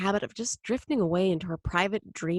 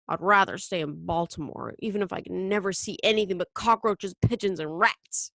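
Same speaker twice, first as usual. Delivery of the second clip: plain reading voice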